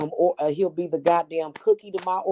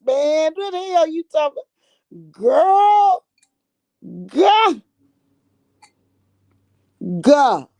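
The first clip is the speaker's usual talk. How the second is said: high, loud squealed exclamations; excited outburst; 10 semitones higher, 7 dB louder than usual. Real, not acted. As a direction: let it burst out high and loud. Exclaiming over the mice's magic.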